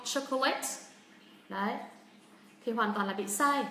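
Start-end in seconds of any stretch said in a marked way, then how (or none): 0.0-0.7 "Chocolate" as "cho-co-LET", pitch climbing on LET; three-syllable "Chocolate" with rising "let"; rising on last syllable. Shown as a demonstration. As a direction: wrongly raise the end of "Chocolate". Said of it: "mình đang lên giọng ở đâu".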